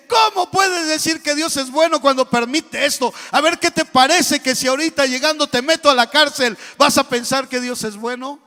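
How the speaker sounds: loud, high shouting king; enraged king shouting threats at his servant; furious shout. Acted. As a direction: roar it out in royal fury. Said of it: "se enoja el rey y le dice".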